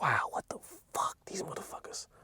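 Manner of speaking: whispered disbelief under breath; whispering what the fuck to himself. Retold or imitated. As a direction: hush it like a secret reaction. Retold private reaction in store.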